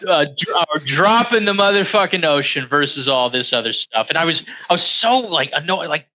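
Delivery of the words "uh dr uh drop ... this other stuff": usual speaking voice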